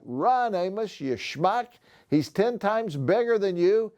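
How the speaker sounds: high, mock-panicked yell; retold urgent shout. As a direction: replay the moment yelling at a friend to run. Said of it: "I was like".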